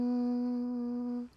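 long, soft monotone hum; buzzing hum. Real, not acted. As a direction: hold a quiet steady hum like a bee. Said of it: "That sounds like a bee buzzing".

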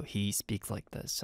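low, soft imitation of a deep voice. Host showing fans how deep Felix speaks, imitated deep voice. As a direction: drop low and quiet, slowing down. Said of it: "speaks with such deep voice".

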